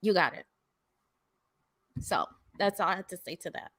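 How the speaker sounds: usual speaking voice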